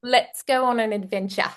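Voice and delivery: put-on light Kiwi accent, a bit self-conscious; New Zealand accent. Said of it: "I have a pretty good New Zealand accent".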